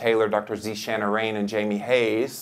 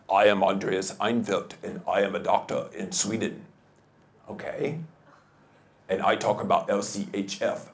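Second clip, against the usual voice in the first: imitating Andreas; 2 semitones lower, 5 dB quieter than usual. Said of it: "That's how he talks".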